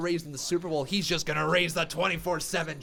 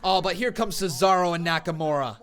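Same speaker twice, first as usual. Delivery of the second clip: excited commentary, louder and higher, building; live play-by-play; 8 semitones higher, 11 dB louder than usual. Real, not acted. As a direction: call the action as it unfolds, voice rising.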